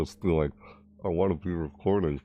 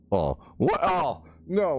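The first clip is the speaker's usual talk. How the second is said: ordinary talk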